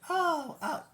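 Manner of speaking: very high, loud oh; a dopey high-pitched oh oh; mock naive squeal. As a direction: swoop way up in fake delight. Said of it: "those people going".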